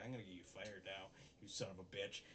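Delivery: mock angry threat. Vice principal threatening, retold.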